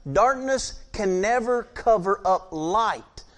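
slow, emphatic preaching. Acted. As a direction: lean into each word like a preacher. Preaching builds on the light.

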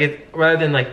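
ordinary delivery

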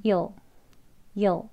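YOU'LL as one short syllable, "yol"; further reduced. Shown as a demonstration. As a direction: squeeze "you'll" into a single beat. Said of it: "더 줄이면 이제 한 글자로".

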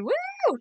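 high-pitched "woo"; short high whoop of good vibes; cheering whoop. Real, not acted. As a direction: let out a quick high cheer.

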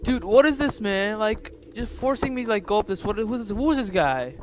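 high-pitched, agitated questioning; panicky protest. Real, not acted. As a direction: protest in real alarm, pitch up. Protesting at the scary game.

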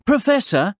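high-pitched call for attention. A narrator's reading. Boy looking for his neighbour, calling out. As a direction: call someone's name into a shed. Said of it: "Billy called".

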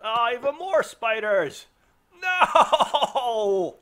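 loud, high panicked shout; real alarm bursting out; panicked yell. Real, not acted. Gamer seeing spiders swarm his squad, panicked yelling.

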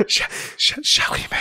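whispered again, stuttering start; whispering. Real, not acted. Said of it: "says it again, whispering".

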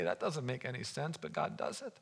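very low, soft aside; hushed. Real, not acted. Preacher marvels at manna from heaven, hushed. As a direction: drop right down to a quiet, low voice.

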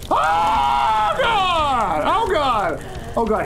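high loud panicked cry; scared shout. Real, not acted. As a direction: cry out in fright. Scare in game.

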